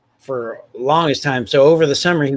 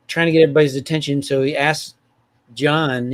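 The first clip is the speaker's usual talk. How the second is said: ordinary delivery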